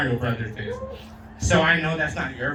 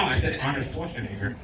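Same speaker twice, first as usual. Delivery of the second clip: ordinary talk, nothing marked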